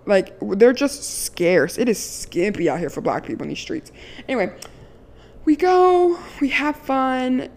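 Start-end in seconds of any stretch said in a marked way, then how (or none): 5.4-7.5 higher, louder, stretched-out we go, we have fun; excited recap. Real, not acted. Telling friends about her Boston trip, excited and gushing. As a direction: gush it like the best trip ever.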